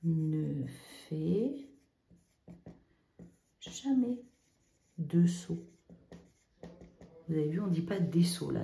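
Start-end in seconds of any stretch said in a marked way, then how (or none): 0.0-5.7 slow dictation. Shown as a demonstration. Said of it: "c'est, ben, jamais".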